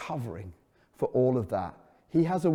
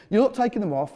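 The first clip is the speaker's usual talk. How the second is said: put-on higher insistent boy voice; child protesting; 7 semitones higher, faster than usual. Son warns daddy about stabilizers, higher protesting child voice. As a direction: insist like a worried little boy.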